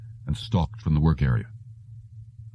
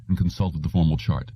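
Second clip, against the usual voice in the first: ordinary delivery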